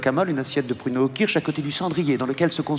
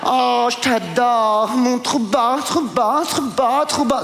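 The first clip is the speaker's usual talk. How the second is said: much higher, gushing society lady; posh lady voice; 6 semitones higher, slower than usual. Acted. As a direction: squeal it like an excited rich woman. Barbara flirting with him.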